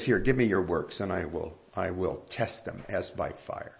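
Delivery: low, soft voice of the Lord, a touch grave; quoted voice of the Lord. Retold or imitated. Preacher quoting the Lord to the congregation, low and solemn. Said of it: "So he says".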